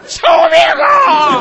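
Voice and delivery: very high, loud, slow hammed-up cry for mercy; a drawn-out comic plea to be spared; begging shout. Cornered husband begs for his life, high drawn-out shout.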